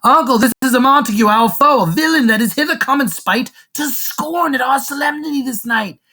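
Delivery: high, loud indignant declamation; mad tattling rant. Acted. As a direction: denounce the intruder in hammed-up fury. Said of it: "Tybalt is mad".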